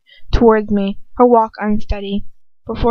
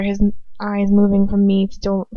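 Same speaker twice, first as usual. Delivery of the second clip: ordinary delivery, nothing marked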